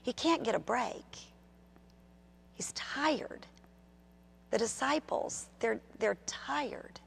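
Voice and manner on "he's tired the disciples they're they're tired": hushed, breathless whisper; soft whispered aside. Acted. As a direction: drop to a whisper for the weary disciples. Sermon, quiet tired moment.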